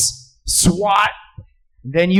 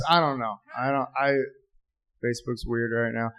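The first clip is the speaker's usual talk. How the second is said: ordinary talk, nothing marked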